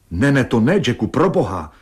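wife desperately stopping her husband at the door; frantic cry. Acted. Frightened wife crying out to stop her husband, frantic. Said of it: "vyjekla v neovladatelném vzrušení".

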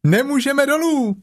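high little kangaroo voice; Roo calling. A narrator's reading. Roo calling down that they are stuck, high. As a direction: chirp it down cheerfully. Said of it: "zvolal klokánek".

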